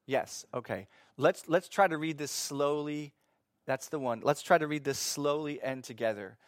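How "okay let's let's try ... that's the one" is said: ordinary talk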